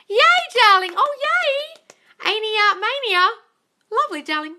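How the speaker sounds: high, loud celebratory squeal; delighted cheer. Real, not acted. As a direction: cheer them on in a high, excited voice. Cheering a viewer by name.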